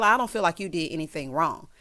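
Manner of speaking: usual speaking voice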